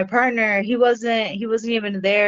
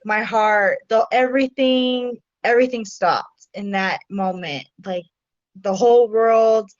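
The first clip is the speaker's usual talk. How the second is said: normal talking voice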